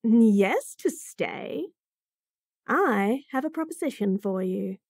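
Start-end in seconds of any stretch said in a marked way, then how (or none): none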